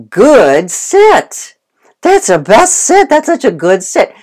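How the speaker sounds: high, loud gushing praise for the dog; hammed-up squealing delight over a sit; overexcited praise. Shown as a demonstration. Trainer gushing at the dog over a sit, high and loud. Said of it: "I was too excited".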